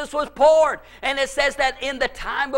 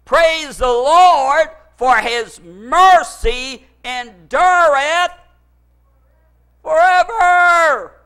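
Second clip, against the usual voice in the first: slow, loud praise, building to a high shouted "forever"; rising praise shout; 3 semitones higher, 6 dB louder, much slower than usual. Retold or imitated. Singers before the army shout praise, building loud. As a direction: swell into a triumphant cry. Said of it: "as they went out before the army to say".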